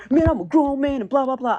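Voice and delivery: high-pitched mocking protest; mock indignation. Trolled coworker protesting he's a grown man, mock indignation. Mocks the angry coworker's retort.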